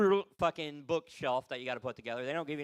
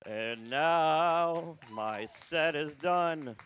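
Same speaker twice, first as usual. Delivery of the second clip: announcer close; 2 semitones lower, 5 dB louder, much slower than usual. Real, not acted. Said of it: "closing his set".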